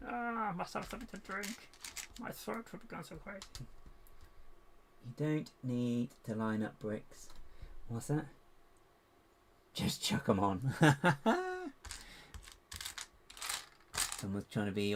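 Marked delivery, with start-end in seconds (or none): 0.1-3.4 high, silly parched complaint; playful put-on voice. Plays up needing a drink. 10.8-13.0 loud, high cackle; long gleeful cackle; real laugh. Real, not acted. Laughs at own joke.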